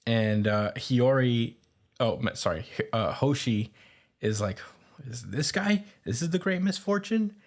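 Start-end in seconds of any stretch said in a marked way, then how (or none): 5.1-7.4 acted-out disbelief, rising pitch; incredulous question. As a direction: voice climbing high in disbelief. Retold character doubts who she sees.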